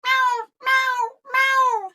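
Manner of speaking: high, loud cat meows; cat meowing. A narrator's reading. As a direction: demand food like a cat. Cat wants more food.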